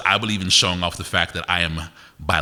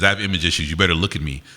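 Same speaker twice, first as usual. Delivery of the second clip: ordinary delivery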